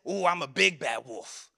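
mock-tough bragging wolf; lightly voiced swaggering wolf. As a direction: puff up like a cocky wolf. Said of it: "The next big bad wolf says".

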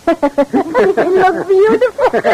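laughing through the words, slowly; a happy laugh over the words; laughing delightedly. Acted. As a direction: giggle at how fine you both look. Husband and wife admiring themselves.

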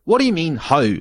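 ordinary talk

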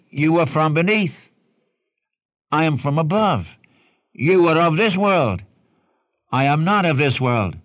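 measured emphasis, each statement set apart; emphatic. Retold or imitated. Preacher quoting Jesus to his opponents, emphatic. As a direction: quote it as a firm pronouncement. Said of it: "the Lord's very emphatic".

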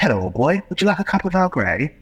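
high, plummy upper-class English gent; posh old chap. Retold or imitated. Plummy English gent offering tea to the man he stabs. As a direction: play a pompous toff offering tea.

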